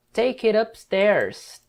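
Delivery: "Take it upstairs" stretched slowly; slow model sentence; slow line demo. Shown as a demonstration. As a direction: read "Take it upstairs" slowly so students catch it. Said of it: "Take, leva".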